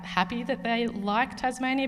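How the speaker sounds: ordinary delivery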